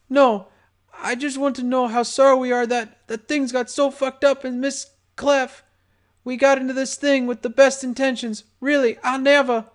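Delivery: high, scared, rushed pleading; frightened pleading. Acted. Butterbread pleading.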